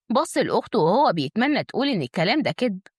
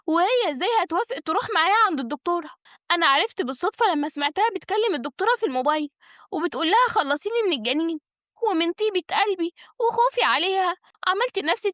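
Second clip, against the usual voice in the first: high, injured woman's voice; pleading schemer; 9 semitones higher than usual. Acted. Schemer persuades the family with her story, pleading. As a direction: plead innocence with aggrieved insistence. Naglaa's self-defence, held voice.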